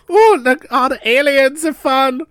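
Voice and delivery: put-on high, loud, slow childlike gasp of wonder; little kid voice. Kid dazzled by Star Wars lights, high wondering voice. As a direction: play a six-year-old dazzled by lights. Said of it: "I had an accent".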